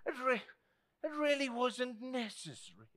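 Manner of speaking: restrained adult politeness. Retold or imitated. Preacher mimics adult receiving a gift, politely restrained.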